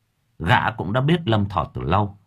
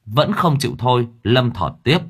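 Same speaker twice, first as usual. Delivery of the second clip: plain reading voice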